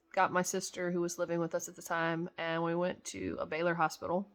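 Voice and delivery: normal talking voice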